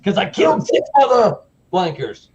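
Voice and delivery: high, loud boasting yell; imitated Scared Straight tirade; menacing prisoner. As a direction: shout it like an inmate terrifying kids. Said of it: "And he goes".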